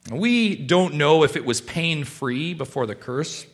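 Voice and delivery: normal talking voice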